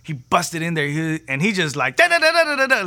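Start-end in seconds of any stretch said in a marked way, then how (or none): 2.0-2.9 high, loud mock-Italian babble; imitating frantic kid. Italian kid bursts in running, frantic mock babble. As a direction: rattle off nonsense syllables like a panicking child. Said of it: "like, freaking out".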